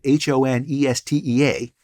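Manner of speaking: H O N E S T E A said letter by letter; name each letter of H O N E S T E A in turn; spelled out. Shown as a demonstration. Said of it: "the way I spelled it".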